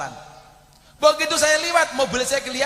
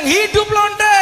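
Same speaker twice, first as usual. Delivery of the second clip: high, loud rally cry; cheering slogan, much higher and louder; shouted slogan; 13 semitones higher, 9 dB louder, much slower than usual. Retold or imitated. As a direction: shout it like a crowd chant. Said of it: "saya bilang".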